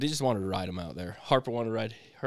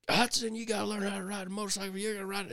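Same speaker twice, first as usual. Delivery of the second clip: higher, fast snappish scolding; irritated dad; 9 semitones higher, faster than usual. Retold or imitated. Dad snapping at son on the way to the farm, fast and higher. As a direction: nip at the boy in the truck. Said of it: "I'd nip".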